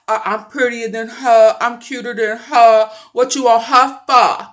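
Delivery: loud, bratty jealous rival voice; ugly stepsister. Acted. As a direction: brag like a spiteful stepsister.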